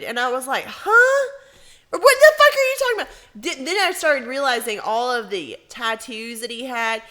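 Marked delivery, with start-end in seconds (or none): 0.7-3.1 very high, loud, baffled squeal; high, fast incredulity breaking into laughter; shocked disbelief. Retold or imitated. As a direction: retell it as a loud falsetto outburst. Retold shocked reply to date.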